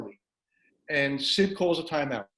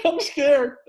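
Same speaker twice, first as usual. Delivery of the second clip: loud, high mock-frightened cry; scared player; 11 semitones higher, 6 dB louder than usual. Player confessing to his coach, high mock scared voice. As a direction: repeat it way up high for the laugh. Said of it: "Johnny looks and goes".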